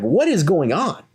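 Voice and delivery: high-pitched retold demand; mock alarmed demand. Manager running up to the fight, mock-exasperated demand. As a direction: imitate a manager breaking up a commotion.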